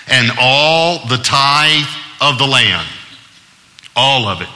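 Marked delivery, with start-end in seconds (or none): 0.1-2.9 "all" drawn out long in a slow reading; stress on "all". Shown as a demonstration. Preacher has listeners notice the word, slow with "all" stretched out. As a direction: read slowly, leaning hard on "all". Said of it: "I want you to notice the word all".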